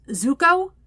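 calling a name through a closed door. A narrator's reading. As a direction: call through the door, unsure. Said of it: "he called out".

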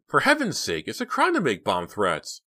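high, loud protest; exasperated scolding. Acted. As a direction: reproach a friend in appalled tones. Dina scolds friend over bomb threat.

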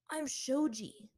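usual speaking voice

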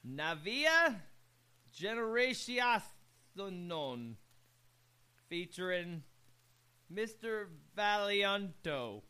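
Navia Generacionon said slowly, syllable by syllable, Spanish attempted; slow careful pronunciation. Real, not acted. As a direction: sound out Navia Generacionon and Mister Valianto haltingly. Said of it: "trying to figure out how to pronounce words".

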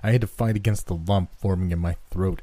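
usual speaking voice

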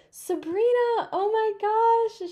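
high, drawn-out excited squeal; hyping someone up. Retold or imitated. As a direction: imitate a friend calling out in amazement. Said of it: "just so hyping it up so much".

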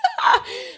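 a very high, squealing laugh; shrieking laugh. Real, not acted. A host shrieking with laughter at her own joke.

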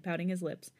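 ordinary delivery

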